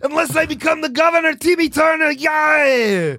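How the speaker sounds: high, hammed-up action-hero wail; Arnold Schwarzenegger. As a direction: shout the news in a thick Austrian accent. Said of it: "your character in Jingle All the Way".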